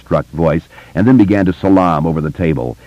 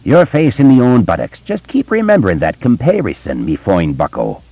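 Irish cop voice; 6 semitones higher than usual. A narrator's reading. Richie repeats his Irish cop jab at Bill. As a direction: lay on the Irish cop accent. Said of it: "you couldn't do an Irish cop".

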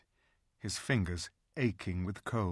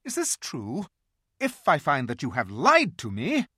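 threatening queen; 6 semitones higher, 6 dB louder than usual. A narrator's reading. Queen demands truth.

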